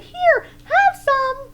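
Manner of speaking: very high, loud little mouse voice; tiny mouse urgently reassuring; squeaky mouse. A narrator's reading. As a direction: squeak it out to comfort the bear. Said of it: "Mouse was brave and said".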